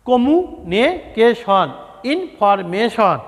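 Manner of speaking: slow, syllable by syllable; teacher drawing out each word so pupils can spell it. Shown as a demonstration. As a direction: spell it out slowly for the class. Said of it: "syllable ভেঙে ভেঙে বানান কর".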